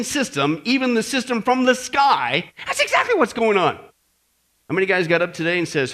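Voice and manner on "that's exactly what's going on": ordinary talk, nothing marked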